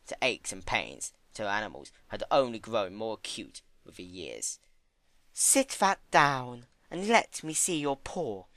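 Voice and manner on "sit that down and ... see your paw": higher, slow, firm command; insist, slow and firm; demanding. Acted. Fluttershy ordering Discord to show his hurt paw, demanding. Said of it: "she demanded".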